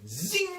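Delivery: high-pitched zing of a bike taking off; imitated bike zoom. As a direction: mimic a motorbike zipping away.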